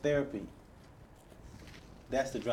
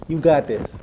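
much louder, firm and rallying; cheering her on, full voice; loud encouragement; 11 dB louder than usual. Real, not acted. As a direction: shout it to lift her up. Encouraging a tearful guest.

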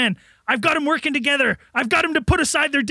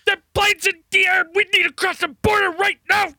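urgent yell; 5 semitones higher, faster than usual. Acted. Official pleading with Frank to cross the border, frantic. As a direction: push it like a panicked officer. Urgent plea, much higher.

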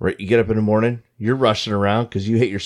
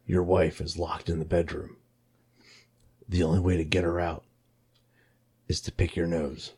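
low, soft menacing game-master voice; softer creepy puppet; sinister puppet voice; 5 semitones lower, 9 dB quieter than usual. Retold or imitated. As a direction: read the rules like the Saw puppet. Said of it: "it's gonna be like".

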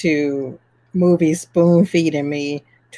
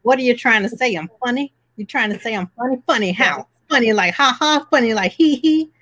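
menacing tough guy, higher pitch; Goodfellas gangster impression; 5 semitones higher, faster than usual. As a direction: demand it like a mobster. Goodfellas funny guy bit.

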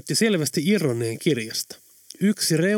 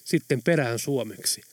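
plain reading voice

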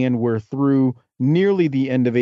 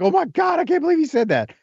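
high, shocked gasp of disbelief; mock shock. Podcast host retelling their reaction, high mock disbelief. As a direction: squeal it like you can't believe your ears. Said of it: "And we were like".

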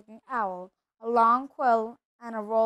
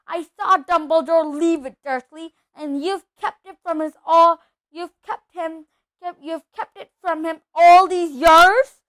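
high, loud, furious accusation; enraged; 5 semitones higher, 11 dB louder than usual. A narrator's reading. Hagrid accuses Dursley of hiding the letter, enraged. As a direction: rail at him, building to a shout. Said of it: "his every syllable trembled with ye-, rage".

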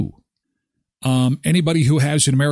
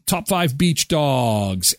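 usual speaking voice

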